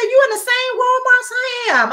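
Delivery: high-pitched imitation of an excited friend; amazed excitement. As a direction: squeal it in happy disbelief. Retells friend's amazed reaction.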